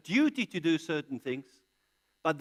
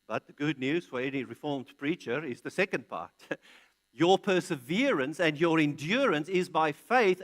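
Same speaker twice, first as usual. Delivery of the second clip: ordinary talk, nothing marked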